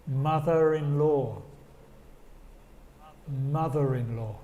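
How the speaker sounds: ordinary delivery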